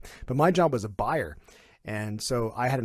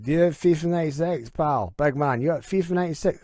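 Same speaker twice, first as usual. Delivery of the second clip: higher, louder Glaswegian bloke; Glaswegian caller; 6 semitones higher, 5 dB louder than usual. Retold or imitated. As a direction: ask like a Glasgow punter on the line. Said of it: "the guy on the other end".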